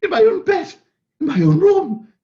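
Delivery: high, loud, giddy joy; a man reborn, almost shouting with joy; overjoyed relief. Acted. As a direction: burst out with disbelieving delight. Scrooge waking, overjoyed.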